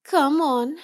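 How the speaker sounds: ordinary talk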